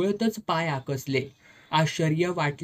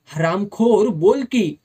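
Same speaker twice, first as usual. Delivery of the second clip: loud, higher furious yell; angry outburst; 4 semitones higher, 8 dB louder than usual. A narrator's reading. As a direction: explode at her in rage. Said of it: "Saheb चिडला".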